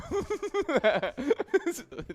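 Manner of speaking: high burst of laughter. Real, not acted. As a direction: break into a real laugh. Host laughs at own joke.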